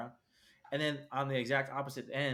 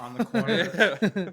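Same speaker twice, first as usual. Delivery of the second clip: laughing mock voice; 6 semitones higher, 12 dB louder, much slower than usual.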